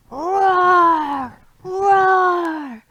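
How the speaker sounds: big loud high roars; lion roar. Acted. Teacher roaring along in the song, loud roar. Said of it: "you get to roar".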